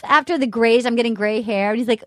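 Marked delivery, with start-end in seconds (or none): none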